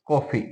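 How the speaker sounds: कॉफी said with the borrowed English vowel, between आ and औ; example word. Shown as a demonstration. Teacher showing students the borrowed vowel, said precisely. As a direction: say कॉफी with a rounded open ऑ, as in coffee. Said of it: "जैसे हम कहते हैं".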